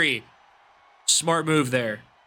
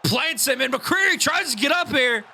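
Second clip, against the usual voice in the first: high excited shouted pin count; wrestling commentator; 8 semitones higher than usual. Acted. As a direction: yell the three count to the finish.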